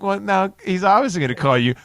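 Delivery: high, loud laughing jeer; laughing taunt. Retold or imitated. Mocking a friend who just got a nickname stuck. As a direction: taunt him while cracking up. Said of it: "I'm like".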